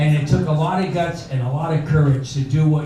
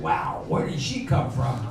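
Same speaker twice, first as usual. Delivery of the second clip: soft amazed aside; 3 semitones lower, 10 dB quieter than usual. Retold or imitated. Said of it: "I said".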